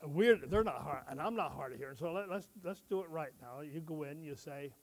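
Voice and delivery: normal talking voice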